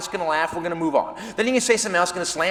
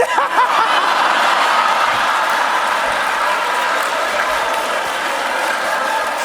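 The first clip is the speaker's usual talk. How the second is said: long, high wheezing laugh; hysterical laughter. Acted. Colleague curled up on the kitchen floor, helpless laughter. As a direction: collapse into helpless, breathy laughter. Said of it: "he is shaking with laughter".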